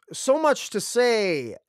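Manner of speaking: high, drawn-out mock enthusiasm; sarcastically overplayed, slow and high; sarcastic. Real, not acted. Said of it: "a little bit of sarcasm there".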